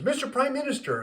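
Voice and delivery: high, loud joke-character address; Biden. Acted. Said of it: "he turns to Netanyahu".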